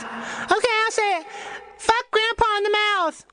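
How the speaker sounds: put-on very high childlike voice; little kid voice. Little Jimmy obeying his parents on camera, very high. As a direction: pipe up like an obliging little kid. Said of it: "Jimmy, say truck".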